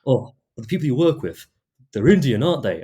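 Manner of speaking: imitating pointed questioning. Customer asking about my co-workers, pointedly. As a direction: probe pointedly, lifting pitch and volume. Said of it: "the way he said that".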